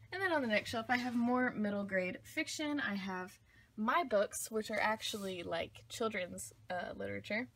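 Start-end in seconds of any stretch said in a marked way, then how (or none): none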